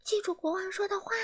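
high, soft little elf voice; elf warning. Acted. Elf warning Mr Rude to stay polite, high and soft. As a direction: warn him gently as a tiny elf. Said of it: "小精灵警告傲慢先生说".

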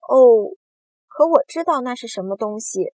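loud burst of recognition; excited cry. A narrator's reading. James recognizing the little green things, crying out. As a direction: exclaim it, starting big. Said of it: "詹姆斯叫了起来".

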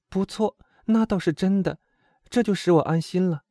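put-on high young woman's voice; reassured girl companion in a storybook voice; relieved timid friend. A narrator's reading. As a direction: agree with relief, pitched high.

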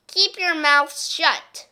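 slow loud order to a noisy class; stern teacher. Acted. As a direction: spell out each word like a strict teacher. Miss Swamp commands the class.

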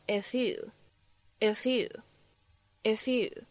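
"if you" run together as one word; linked pronunciation. Shown as a demonstration. Teacher showing students liaison, "if you" linked. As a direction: link the f of "if" straight into "you". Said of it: "类似于连读的处理".

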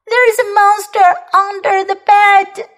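put-on high, upset child's wail; mock sobbing. Kipper telling parents what is under the bed, mock sobbing. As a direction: complain fearfully in a high voice. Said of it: "he sobbed".

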